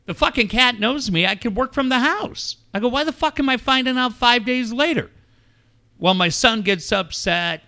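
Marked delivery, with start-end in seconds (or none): 0.0-2.6 exasperated, higher-pitched ranting at family; worked-up retold tirade; retold angry yelling. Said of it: "I yelled at my son". 2.9-5.1 exasperated, high-pitched demand; worked-up retold outburst; retold angry yelling. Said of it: "I yelled at my son and I yelled at my ex".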